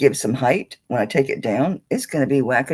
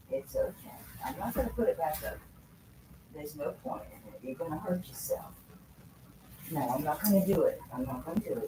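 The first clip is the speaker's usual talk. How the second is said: quiet, tender and reassuring, higher; soft soothing to the dog; 4 semitones higher, 9 dB quieter than usual. Real, not acted. Owner gently checks her dog after it knocked things over, soft and tender. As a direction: hush it down, coax a scared pet.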